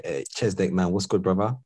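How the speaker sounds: ordinary talk, nothing marked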